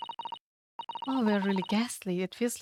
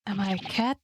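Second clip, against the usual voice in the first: ordinary talk, nothing marked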